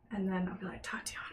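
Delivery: hushed sleepover whisper. Shown as a demonstration. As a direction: whisper so no one wakes. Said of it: "Sleepover voices".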